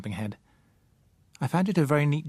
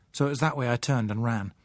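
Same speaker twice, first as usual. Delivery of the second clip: usual speaking voice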